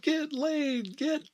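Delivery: high, loud imitation of a chanting crowd; rowdy high-pitched party chant, acted out; crowd yelling. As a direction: yell it like a whole prom gone wild. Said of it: "everybody's yelling".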